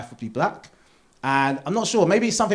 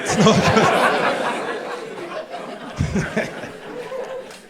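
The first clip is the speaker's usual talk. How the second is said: airy laugh; 14 semitones higher than usual. Real, not acted. Laugh after punchline.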